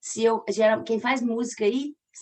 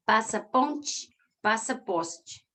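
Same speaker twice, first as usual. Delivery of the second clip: passa ponte, passa poste said slowly, syllable by syllable; slow scansion; much slower than usual. Shown as a demonstration. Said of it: "Tudo tem três sílabas poéticas".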